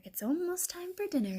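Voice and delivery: high, lightly purring fox; purred. A narrator's reading. Fox lighting up the den, purring. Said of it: "he purred".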